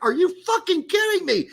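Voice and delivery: very high-pitched disbelief; squeaky-high, appalled exclamation; incredulous outrage. Retold or imitated. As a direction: retell your shock with the voice shooting up. Retells own outraged reaction.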